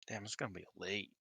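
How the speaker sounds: ordinary talk, nothing marked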